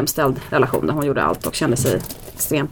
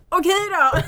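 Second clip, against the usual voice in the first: high, giggling okay; mother amused by son; laughing okay then; 13 semitones higher than usual. Retold or imitated. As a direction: laugh it out helplessly. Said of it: "Jag bara".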